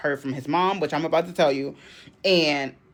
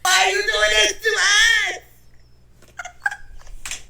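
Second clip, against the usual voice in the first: high, loud, hammed-up crying shout; mock wailing girlfriend; 5 dB louder than usual. Playing the distraught girlfriend to the boyfriend, high and loud mock wail. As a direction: wail it like a soap opera. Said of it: "play the distraught girlfriend".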